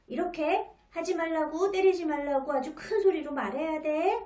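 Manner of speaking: put-on mother sternly ordering her child to shout back; stern insistence. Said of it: "좀 더 엄하게 말을 합니다".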